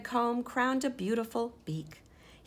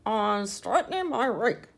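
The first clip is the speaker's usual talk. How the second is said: louder, muffled words through a stuck beak; 2 semitones higher, 9 dB louder than usual. Acted. Henrietta answering the mouse with her beak stuck, muffled. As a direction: talk with your face pushed into a hole.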